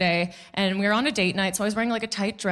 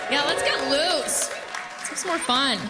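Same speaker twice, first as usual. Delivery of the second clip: rallying; 5 semitones higher than usual. Real, not acted. Rallying the audience.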